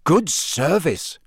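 slow, impressed drawl; mildly awed, stretched-out approval. Acted. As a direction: draw it out in admiration. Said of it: "Ron said in an impressed voice".

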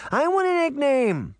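put-on very high-pitched eager little girl; excited child's cry. A narrator's reading. Little girl begs her uncle, excited high cry. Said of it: "Daphne cried".

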